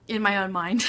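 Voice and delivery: ordinary talk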